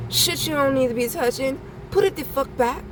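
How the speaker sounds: high, sharp scolding. Pretend. Telling altar meddlers to put it back, scolding. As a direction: scold them like a mother catching a thief.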